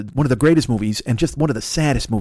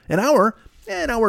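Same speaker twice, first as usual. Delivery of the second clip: "An hour?" jumps much higher, pushed up in disbelief; mock incredulous; 10 semitones higher than usual. Bargaining his breath-holding time down, mock incredulous. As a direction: balk at "An hour?" like it's absurd.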